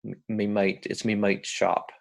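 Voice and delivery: amateur broad Aussie; not-great Australian accent; rough Australian accent. Shown as a demonstration. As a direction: imitate a broad Australian, vowels shifted. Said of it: "I don't do a great Australian accent".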